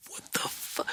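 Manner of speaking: breathy whispered half-curse. Retold or imitated. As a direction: whisper it under your breath. Stunned dad swallows curse.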